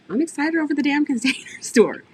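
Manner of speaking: rising to a loud excited invite; gushing excitement. Pretend. Voicing carefree excited self.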